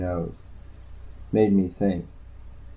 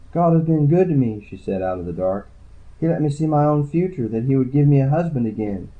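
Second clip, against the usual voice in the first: lightly raised voice for the tired, shy woman; woman's voice; 6 semitones higher than usual. Acted. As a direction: read her lines a little higher and wearier. Woman speaks of her future.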